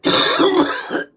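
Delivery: loud, high hacking cough; hard choking cough. Real, not acted. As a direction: cough it up forcefully from the throat. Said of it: "Just like that".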